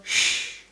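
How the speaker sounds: long hushing "sh" on its own; sonido sh. Shown as a demonstration. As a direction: say an isolated, voiceless "sh". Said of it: "acabado en el sonido". (sonido «sh» aislado y alargado)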